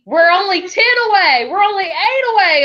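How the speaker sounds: mock cheerful update. Director cheering her unit on, high mock excitement. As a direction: pretend delight at being close. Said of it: "and I go".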